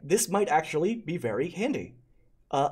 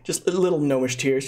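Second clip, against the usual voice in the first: normal talking voice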